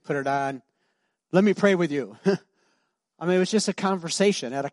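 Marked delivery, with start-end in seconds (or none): 0.0-2.2 a lightly hammed-up pompous pastor, louder; a touch of a preachy pastor voice; mock pastor. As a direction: play the showy pastor for a laugh. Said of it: "I don't go".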